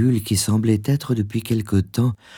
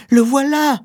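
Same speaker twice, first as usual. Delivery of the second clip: very high-pitched cry; high, triumphant exclamation; exclaimed discovery; 4 dB louder than usual. A narrator's reading. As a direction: cry out in a high, excited wife's voice. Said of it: "s'écria-t-elle".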